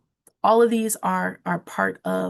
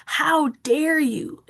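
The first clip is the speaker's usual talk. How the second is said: slow, higher-pitched mild indignation; mock outrage; 6 semitones higher, slower than usual. Shown as a demonstration. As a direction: act out an indignant outburst without really yelling. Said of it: "raging, yelling, screaming".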